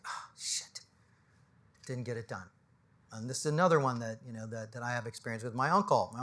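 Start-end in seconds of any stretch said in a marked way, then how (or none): 0.0-2.5 very soft, whispered dying regret; imagined deathbed murmur, hushed and low. Pretend. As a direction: breathe it out like last words.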